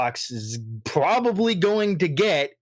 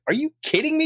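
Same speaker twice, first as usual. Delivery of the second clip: incredulous squeal; 12 semitones higher, faster than usual. Retold or imitated. Telling off Sony fans, very high incredulous. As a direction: voice leaps way up in disbelief. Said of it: "And I'm just like".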